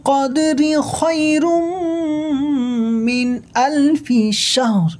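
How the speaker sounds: high, very slow chanted recitation; Quran recitation. Shown as a demonstration. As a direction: draw every vowel out in melodic tajweed. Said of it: "কেমন হবে পুরো আয়াতটা".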